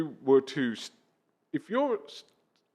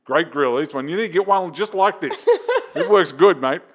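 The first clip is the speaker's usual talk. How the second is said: put-on cheeky Australian accent, louder; 4 semitones higher, 6 dB louder than usual. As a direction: sell it with a grinning Australian accent. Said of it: "You got an Australian accent".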